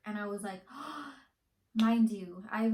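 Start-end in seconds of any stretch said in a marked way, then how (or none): 0.7-1.1 gasp. Retold or imitated. Hearing she got approved.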